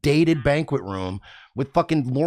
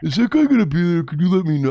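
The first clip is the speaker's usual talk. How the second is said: mocking needy fan; 3 semitones higher, 4 dB louder, faster than usual. Mimicking fans who message him, quick mocking voice. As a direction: rattle it off like a pestering fan.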